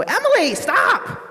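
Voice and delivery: high, loud call to stop; retold shout. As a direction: shout her name to halt her. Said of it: "I hear a voice go".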